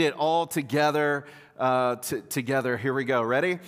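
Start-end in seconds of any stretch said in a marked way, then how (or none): none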